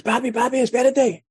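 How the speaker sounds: high, loud imitation of an eager boy; voiced kid, thrilled about the big day; excited son. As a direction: burst in calling to Dad.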